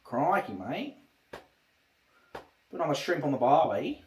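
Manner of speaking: cliché Australian accent, lightly put on; stock Australian accent. Streamer playing up Australian clichés, light Australian accent. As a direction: toss off Aussie clichés in the accent. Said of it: "Australian accent".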